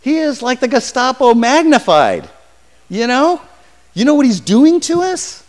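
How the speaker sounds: alarmed Ananias. Acted. Ananias protesting to the Lord, high and alarmed. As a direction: plead in disbelief with the Lord. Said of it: "Ananias is saying".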